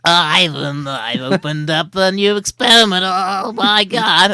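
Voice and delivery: loud cartoon mad scientist; Professor Frink. Showing co-host his impression, loud cartoon professor. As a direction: blurt it like a flustered cartoon professor. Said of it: "so I could do Professor Frink".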